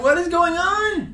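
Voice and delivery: high, drawn-out exasperated bewilderment; baffled disbelief. Retold or imitated. Storm chaser puzzled by identical clips' views, baffled. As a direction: stretch it out in mock disbelief. Retells bafflement at view counts.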